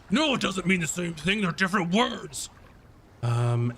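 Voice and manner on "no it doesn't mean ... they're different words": high-pitched contradicting character voice; higher, indignant put-on voice; Zar. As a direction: squabble back, insisting on the point. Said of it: "Zar goes".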